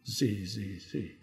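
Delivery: usual speaking voice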